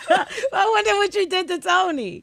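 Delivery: high, slow giggly tease; laughing while teasing. Real, not acted. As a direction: laugh the words out, drawn out. Teasing laugh about Tony.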